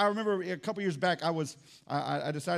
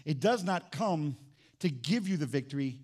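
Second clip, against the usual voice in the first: usual speaking voice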